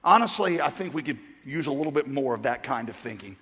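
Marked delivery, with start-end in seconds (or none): none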